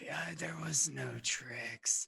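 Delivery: rasping, wheezing creature. Acted. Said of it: "rasping, wheezing voice".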